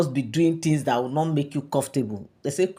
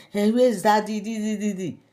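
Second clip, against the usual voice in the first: higher-pitched imitation of a crying child; mock crying; 6 semitones higher than usual. Mom mimicking her crying son to his father, mock crying. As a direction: whine it like a child crying for Daddy. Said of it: "He was crying".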